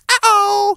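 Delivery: high squeaky imitation of the ICQ alert; ICQ uh-oh sound. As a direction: chirp it like the old ICQ notification. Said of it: "You remember that?".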